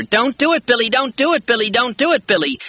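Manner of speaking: put-on very high, loud desperate child's yell. A narrator's reading. Little boy yells his plea at Billy, very high and loud. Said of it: "But Elliot yells louder".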